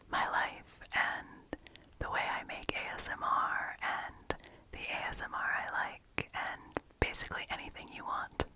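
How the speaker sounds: deep, breathy repeated ASMR whisper; whispery. Real, not acted. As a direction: drop into a close tingly whisper. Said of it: "Whenever I get whispery like that".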